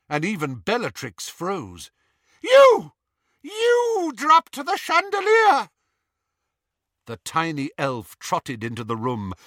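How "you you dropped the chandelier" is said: very high, loud, slow accusing shriek; furious shriek. Acted. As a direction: scream the accusation. Bellatrix shocked outburst.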